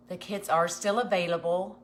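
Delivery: ordinary delivery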